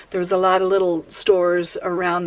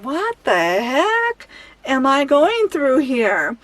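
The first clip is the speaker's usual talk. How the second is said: slow, drawn-out exasperation; exasperated thought; 3 semitones higher, slower than usual. Retold or imitated. Retelling her thought at the locked cart, slow and exasperated. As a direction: stretch it out in disbelief. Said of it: "But I'm thinking".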